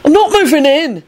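high, emphatic denial; vehement. A narrator's reading. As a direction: protest it hard, voice jumping up. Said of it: "Neville replied vehemently".

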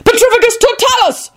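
high, ringing incantation; spell cry. A narrator's reading. Wizard casting a spell.

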